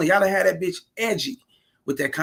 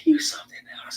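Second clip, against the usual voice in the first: whispered tease; 11 semitones higher than usual. Retold or imitated. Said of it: "She said".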